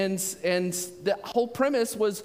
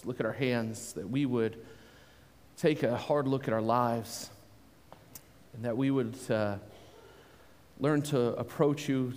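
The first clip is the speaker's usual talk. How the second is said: soft, low opening prayer; 9 semitones lower, 6 dB quieter than usual. Acted. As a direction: pray quietly and humbly before the sermon.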